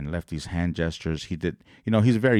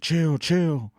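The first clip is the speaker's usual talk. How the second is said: imitating crowd yelling; 9 semitones higher than usual.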